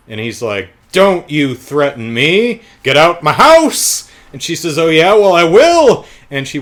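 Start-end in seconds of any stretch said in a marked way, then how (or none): 0.9-4.1 slow, loud, blustering order; mock angry shout. Producer throwing woman out of his house, mock angry shout. Acted quarrel, he throws her out. 4.8-6.1 loud, high-pitched comeback; mock defiant yell. Woman answering the producer's threat, mock defiant yell.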